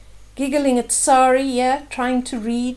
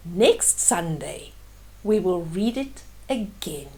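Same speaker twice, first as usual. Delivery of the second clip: tender; 6 semitones lower, 5 dB quieter than usual. Acted. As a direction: reassure her gently. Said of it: "patting Sari's head".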